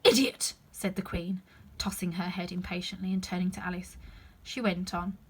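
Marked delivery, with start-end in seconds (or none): 0.0-0.5 loud contemptuous bark; scornful snap. Acted. Queen snaps at the Knave, loud. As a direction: spit the insult.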